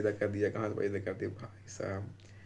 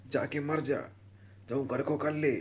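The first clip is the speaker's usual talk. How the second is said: higher, lightly voiced scolding mother; angry mom imitation; 4 semitones higher than usual. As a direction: mimic mom snapping at her kid. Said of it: "ऐसे बोल दी थी mummy".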